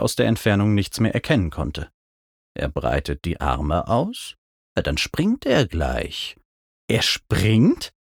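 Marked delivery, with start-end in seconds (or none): 2.5-6.4 low, quiet questioning reply; unimpressed Mac. A narrator's reading. Flying mount answers the narrator's report, unimpressed. 6.9-7.9 questioning. A narrator's reading. Startled question before the jump.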